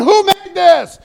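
high, very loud mock scream; retold screaming. Preacher imitates a diner scaring the restaurant, screaming demand. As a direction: bellow it like an irate diner making a scene. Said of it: "he's screaming".

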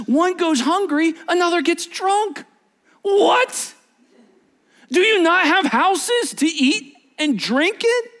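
high, incredulous scolding of the church; exasperated outrage. Acted. Paul scolding the church over drunken suppers, incredulous and exasperated. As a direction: voice Paul's disbelief at the Corinthians. Preacher voicing Paul's outrage.

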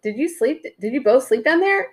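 high-pitched mock parental question; lightly voiced questioning mother. Boyfriend's mother asks the couple at breakfast, lightly imitated, high questioning voice.

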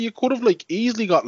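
high, exasperated outburst. Real, not acted. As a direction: raise your pitch in disbelief. Exasperated rant.

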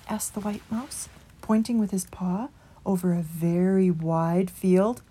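plain reading voice

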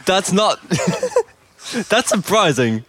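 laughing. Real, not acted. Laughing through water game.

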